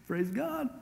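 high, put-on goofy voice; clueless praise. Clueless bridegroom blurting praise with mock naivety. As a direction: play the clueless groom.

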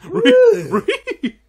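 high, loud ree squeal; mock squeal. Gamer goofing around, a high silly squeal. Imitated noise.

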